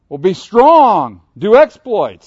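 loud, slow, emphatic proclamation; full-force sermon climax; preaching at full force. Real, not acted. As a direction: drive it home to the congregation. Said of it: "preaching climax".

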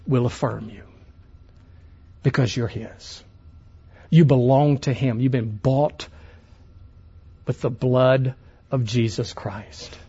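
a hint of intimate tenderness; tender, hushed reassurance. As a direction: comfort the congregation gently. Tender lowered preaching.

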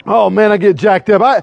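normal talking voice

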